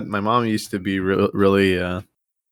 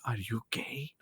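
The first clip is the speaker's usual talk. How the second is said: much softer whisper, slow; mock mom whispering her suspicion; whispered question; 9 dB quieter, slower than usual. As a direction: whisper it secretly like a worried mom. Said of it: "like, really quietly".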